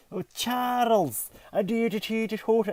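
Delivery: high, slow mock Irish lilt, hammed up; a terrible Irish accent passed off as Scottish; bad Irish accent. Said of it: "And it's so Irish, it's not Scottish".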